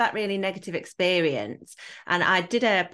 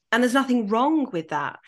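ordinary delivery

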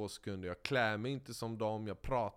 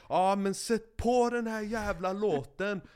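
shouted demand; 9 semitones higher, 6 dB louder than usual. Retold or imitated. Demanding the officials play his song.

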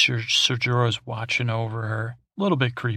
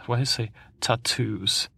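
ordinary delivery

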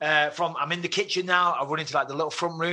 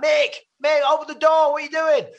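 high, loud shout through a door; raised, urgent calling voice; mates calling out; 12 semitones higher, 7 dB louder than usual. Acted. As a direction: holler to a mate to open up. Acting mates banging on door.

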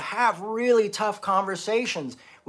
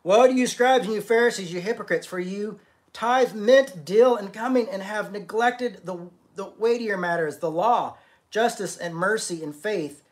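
scripture preaching; 3 semitones higher than usual. Acted. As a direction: hammer each word of the rebuke. Reads the woes again.

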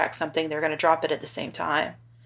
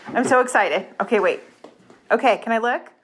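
contained excitement; 5 semitones higher, 3 dB louder than usual. Real, not acted. Mom before seeing son in prom suit.

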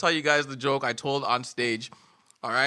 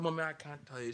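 quiet; 16 dB quieter, faster than usual. Retold or imitated. Child voiced in joke.

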